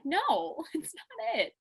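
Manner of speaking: high-pitched, laughing objection; mock protest. Retelling her argument over a word, high mock protest. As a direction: squeal a playful denial.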